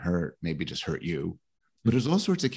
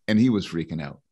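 ordinary talk, nothing marked

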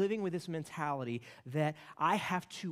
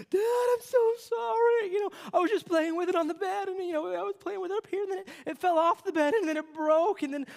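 put-on high, tearful child's voice, much louder; crying little boy; 9 dB louder than usual. Son voiced crying sorry to dad, high and tearful. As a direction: play the sobbing four-year-old apologizing. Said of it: "and he's, he's crying".

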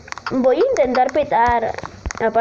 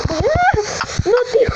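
high-pitched startled cry; yelp, then protest in a high voice; alarmed, high gamer wail; startled panic; 12 semitones higher, faster than usual. Real, not acted. Collapse happens in game.

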